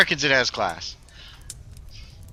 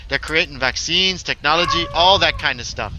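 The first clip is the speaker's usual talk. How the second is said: louder, higher character voice; impassioned game character; 5 semitones higher, 5 dB louder than usual. Acted. Character defending the scientists to a colleague, impassioned voice. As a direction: play an idealist pressing the point.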